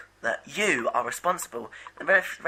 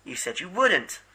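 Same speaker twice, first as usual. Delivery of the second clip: ordinary delivery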